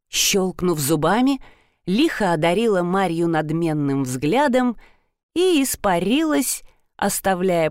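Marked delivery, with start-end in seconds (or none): none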